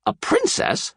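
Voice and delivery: high, startled exclamation; thrilled disbelief at a princess; surprised cry. A narrator's reading. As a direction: jump up in pitch at the news. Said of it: "Shrek cried".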